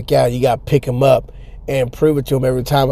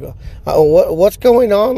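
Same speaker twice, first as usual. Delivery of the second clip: put-on hesitant high voice of an uneasy kid; mock scared kid; 6 semitones higher, 3 dB louder than usual. Kids reacting to the guitar guy, mock scared. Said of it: "like, more scared".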